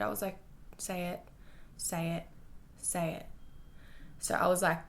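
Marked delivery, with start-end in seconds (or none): 0.8-3.3 softer, hushed repeated nudge; inner voice urging. Retold or imitated. Working up courage before asking him back, hushed. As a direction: push yourself quietly, under your breath. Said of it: "like, in my head".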